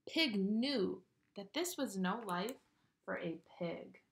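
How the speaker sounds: put-on lively read-aloud to children; animated picture-book narration; storybook reading. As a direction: read it like bedtime story time.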